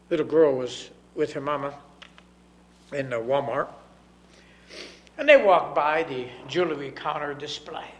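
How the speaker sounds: ordinary talk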